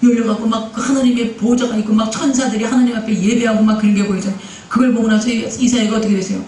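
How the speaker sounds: ordinary talk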